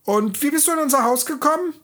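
quick, eager little question; dwarf asking the girl how she got in; curious dwarf. A narrator's reading.

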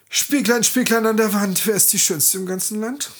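queen's ritual question; imperious queen. Acted. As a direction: demand an answer from the mirror. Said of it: "befragte derweil die Königin den Spiegel".

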